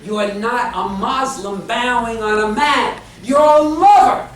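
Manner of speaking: forceful, high sermon delivery; rousing pulpit cry; impassioned preaching. Real, not acted. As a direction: preach it loud, hammering each short phrase.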